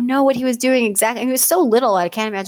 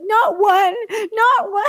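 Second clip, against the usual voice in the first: put-on high, loud little-boy wail; wailing child; 13 semitones higher, 6 dB louder, much slower than usual. Nephew wails that he has no toys left. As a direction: wail it like a toddler losing everything. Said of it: "He's like".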